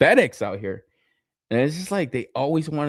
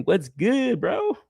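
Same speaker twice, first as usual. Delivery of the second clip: imitating a friend's greeting; 7 semitones higher, much slower than usual. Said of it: "Like my man B Weir saying".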